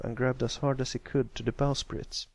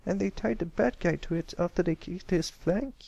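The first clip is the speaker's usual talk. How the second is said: eager filly; 8 semitones higher than usual. Acted. Scootaloo keeps asking.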